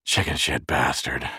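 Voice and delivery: low, slow mutter through pain; strained, slow, low-pitched insult; pained panting. A narrator's reading. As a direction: curse under the strain of pain. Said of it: "panting with pain".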